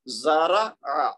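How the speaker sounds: زارا آ slow, ending on a throaty ain; ain sound. Shown as a demonstration. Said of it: "عین کی آواز نکلے".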